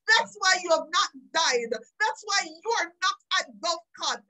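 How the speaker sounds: usual speaking voice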